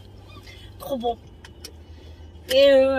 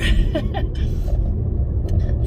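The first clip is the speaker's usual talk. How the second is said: sneeze. Real, not acted. Sneezing fit in car.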